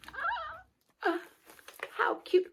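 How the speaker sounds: delighted squeal. Real, not acted. Unboxing Christmas shoes.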